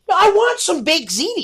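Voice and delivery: put-on whiny kid. Boy in line at the beach demanding ziti, high whine.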